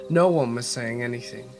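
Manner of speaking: usual speaking voice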